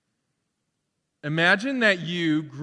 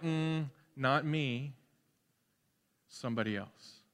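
offhand John; 3 semitones lower, 9 dB quieter than usual. Acted. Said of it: "And John's like".

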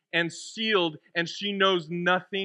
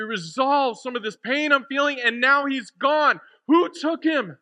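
Mary; 5 semitones higher than usual. Acted. Mary at the empty tomb, increasingly distraught. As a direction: build from recounting to distraught questioning. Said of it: "how Mary must have felt".

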